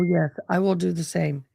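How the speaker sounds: ordinary delivery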